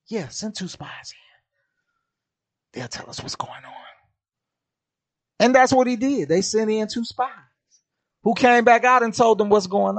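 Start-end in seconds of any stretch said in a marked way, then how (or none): 0.1-3.7 breathy whispered reassurance; mock whisper. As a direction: whisper it like a secret plan. God answers in hushed voice.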